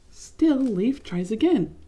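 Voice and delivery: ordinary delivery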